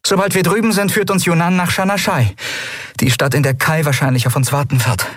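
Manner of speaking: rally them with a bright, quick voice; a young captain giving the order to go; captain Endriel. A narrator's reading. Endriel's voice, held.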